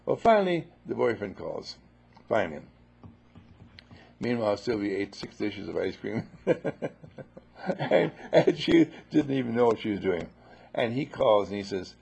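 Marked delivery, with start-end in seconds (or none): none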